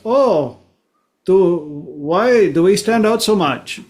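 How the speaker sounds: put-on puzzled tourist with a Swiss accent; lightly mocked Swiss accent. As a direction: imitate a Swiss visitor asking. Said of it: "we are from the Switzerland".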